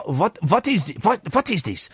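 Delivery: halting attempt at a German accent; mock German accent. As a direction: feel your way into a put-on German accent. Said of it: "trying to do a German accent".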